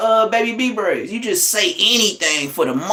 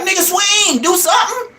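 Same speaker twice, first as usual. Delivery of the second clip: much higher shout of swing, do something; yelling at the TV; 11 semitones higher than usual. Retold or imitated. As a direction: yell at the fighters on the screen. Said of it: "I was like".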